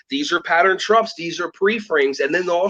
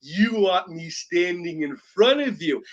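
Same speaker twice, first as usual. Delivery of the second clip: put-on kid's sly voice; 3 semitones lower, slower than usual. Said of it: "he'll go like this".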